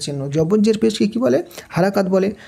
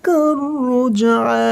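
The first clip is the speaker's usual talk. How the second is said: slow, higher chanted tajweed recitation; Quran recitation; 3 semitones higher, much slower than usual. Shown as a demonstration. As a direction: recite the verse slowly and melodiously. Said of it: "Quran recitation of the verse".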